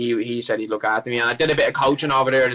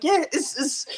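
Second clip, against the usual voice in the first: flustered; 14 semitones higher, slower than usual. Retold or imitated. Stammering at staff.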